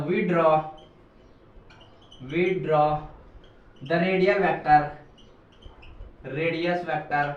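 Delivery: slow, word-by-word dictation with long pauses. Shown as a demonstration. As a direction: dictate each word slowly for students to write. Teacher dictates notes word by word. (धीमे-धीमे रुक-रुक कर लिखवाना)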